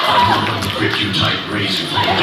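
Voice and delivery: deep growl. Retold or imitated. Mocking a co-star's deep gruff tough-guy voice. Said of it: "the guy on the show with the deep voice".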